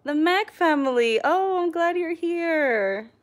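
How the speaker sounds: high, drawn-out welcome; light up as they join; excited greeting. Real, not acted. Greeting a new viewer on the live stream, excited and drawn out.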